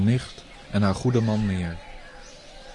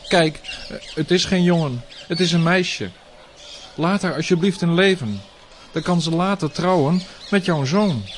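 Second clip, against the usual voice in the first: pleading; 6 semitones higher, 3 dB louder than usual. Acted. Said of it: "smeekte Devaki".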